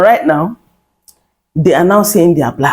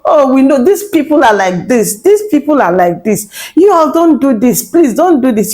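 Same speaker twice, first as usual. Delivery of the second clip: higher, pleading mock voice of the elders; a hammed-up plea, pitched up; 6 semitones higher than usual. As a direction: whine it like a nagging warning. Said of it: "You all have been talking like this".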